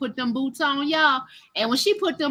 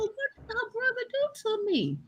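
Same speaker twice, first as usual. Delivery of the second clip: hushed bewildered question; 9 semitones higher, 10 dB quieter than usual. Retold or imitated. Confused inner question.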